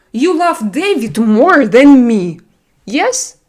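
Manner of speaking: slow, loud, hammed-up sulk; mock jealous whine. Streamer teasing a viewer who prefers David, in a mock jealous whine. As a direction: pout it out like a jilted lover.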